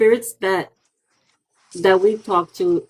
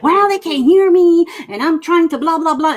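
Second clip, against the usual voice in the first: high, complaining ghost voice, louder at the start; whiny spirit; 10 semitones higher, 4 dB louder than usual. Retold or imitated. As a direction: whine like an ignored spirit. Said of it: "they're like".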